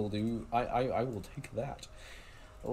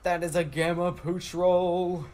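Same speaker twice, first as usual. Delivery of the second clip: excited announcement; 5 semitones higher, 5 dB louder than usual. Real, not acted. Excited rare catch announcement.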